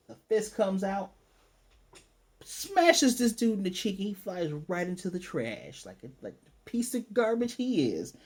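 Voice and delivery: high, dramatic blow-by-blow; excited, high-pitched play-by-play; hyped storytelling. Real, not acted. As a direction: sell the punch like an action climax. Excitedly narrates the punch.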